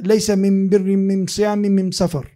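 "من بر من صيام من سفر" with the am- article in place of al-, said "am-birr, am-siyam, am-safar"; "من بر من صيام من سفر" said with the Himyar tribe's am- article; Himyari dialect. Shown as a demonstration. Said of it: "he spoke to him in his own accent".

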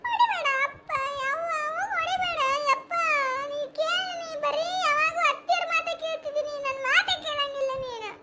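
high, pleading cry; wife pleads with her husband not to hit her. Acted. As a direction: beg him desperately not to hit her.

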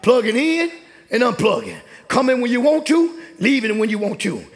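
impassioned, insistent church exhortation; fervent preaching. Real, not acted. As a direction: build the list like a fired-up preacher. Preaching at full pitch.

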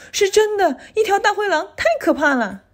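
put-on high, urgent child's voice; acted childlike fright; frightened child insisting. A narrator's reading. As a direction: insist in high-pitched alarm.

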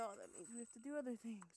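ordinary talk, nothing marked

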